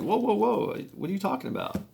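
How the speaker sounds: high-pitched retold protest; mild alarmed protest. Steve answering the FBI's accusation, taken aback.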